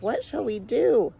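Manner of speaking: high, anxious cry of a worried mother; a frightened wail, pitched high; heartfelt cry. A narrator's reading. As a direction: let it break out as a plea of helplessness. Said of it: "the heartfelt cry of".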